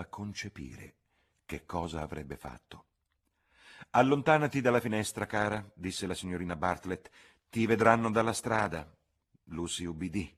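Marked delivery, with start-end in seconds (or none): 3.9-8.9 louder, higher urgent command; anxious order; urgent chaperone. A narrator's reading. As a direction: call her back from the window. Charlotte orders Lucy away.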